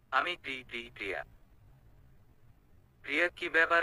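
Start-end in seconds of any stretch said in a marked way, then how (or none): 0.1-1.2 stuttering out her name, trailing softer; nervous stammer. Acted. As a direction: trip over the first syllable, losing nerve. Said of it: "এমন তোতলাচ্ছেন কেন".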